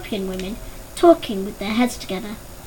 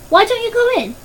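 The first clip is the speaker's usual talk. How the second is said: higher, raised voice across the pool; calling out; 10 semitones higher, 3 dB louder than usual. A narrator's reading. Maid urges Danny from across the pool, higher called voice. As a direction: push him to get in.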